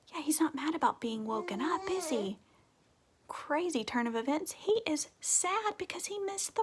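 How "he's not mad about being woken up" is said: usual speaking voice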